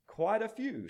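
high, drooping reply, a touch weary; sighed. A narrator's reading. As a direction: admit it with a mild sigh. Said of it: "sighed the imps".

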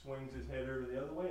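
ordinary delivery

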